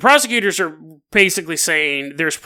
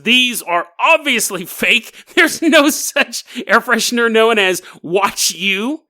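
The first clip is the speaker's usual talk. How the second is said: much higher, slow, smug know-it-all lecture; mock know-it-all David; 7 semitones higher than usual. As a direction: play the self-satisfied expert exposing fakes. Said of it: "he goes".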